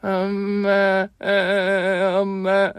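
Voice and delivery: high, slow sobbing calls; child crying. Acted. Boy sobs at his mother's photo, high and slow. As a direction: cry out for mother like a little boy. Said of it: "엉엉 소리내 울고".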